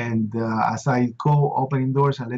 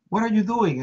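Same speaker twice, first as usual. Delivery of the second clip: a mother's alarmed cry, pitched up; mother yelling down the phone line, mildly raised; retold screaming mother; 7 semitones higher than usual. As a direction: imitate his frantic mother on the phone. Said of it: "and she's screaming".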